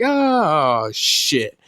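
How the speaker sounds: loud, high drawn-out oh, dropping on the curse; a mock cry of oncoming doom; alarmed outcry. John Walker as Bucky shoots a bomb at them, alarmed outcry. Acted reaction to incoming bomb.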